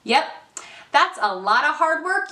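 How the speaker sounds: usual speaking voice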